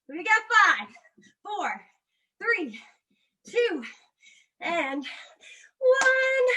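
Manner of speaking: empty the tank with them; fitness coach cues. Acted. Coach counting down the last push.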